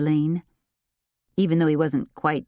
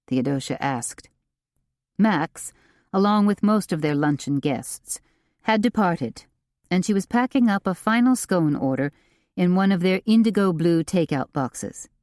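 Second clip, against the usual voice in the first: usual speaking voice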